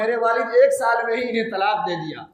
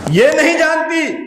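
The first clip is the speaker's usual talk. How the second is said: higher and louder, pleading insistence; impassioned cry; 7 semitones higher, 5 dB louder than usual. Retold or imitated. Son insisting to the doctor about his mother, raised and pleading. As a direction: cry it out with a breaking plea.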